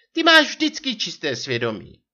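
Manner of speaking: mock him as a stagey wife; divadelní posměch. A narrator's reading. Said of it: "zachechtala se divadelně". (divadelně posměšný vysoký hlas)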